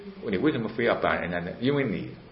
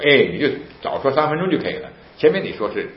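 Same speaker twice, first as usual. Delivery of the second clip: retold scolding officer; 8 semitones higher, 7 dB louder than usual.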